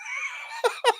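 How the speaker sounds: real high-pitched cackle at own punchline; high burst of laughter. Real, not acted. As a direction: crack up helplessly, high.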